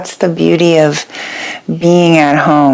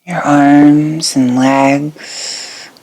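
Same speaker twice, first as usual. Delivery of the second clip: guided meditation. Acted. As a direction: guide them gently into rest, letting each phrase settle. Leads a yoga nidra meditation.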